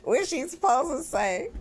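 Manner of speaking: mock outraged comeback; exasperated retort. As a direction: throw it back at her, half-laughing and exasperated. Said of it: "I said".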